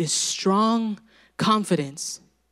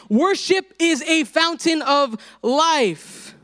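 higher, emphatic preacher's delivery; impassioned preaching; 7 semitones higher than usual. Real, not acted. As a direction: drive each phrase home from the pulpit.

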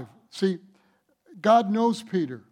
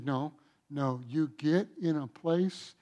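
much softer and lower; hushed; 6 semitones lower, 9 dB quieter than usual. Real, not acted. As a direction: sink into a quiet hush. Preacher describes sitting with God.

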